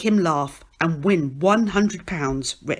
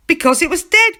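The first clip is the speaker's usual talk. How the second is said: high, louder punchline cry; cries out; 10 semitones higher, 7 dB louder, faster than usual. Acted. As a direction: blurt the punchline triumphantly. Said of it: "cries Stan".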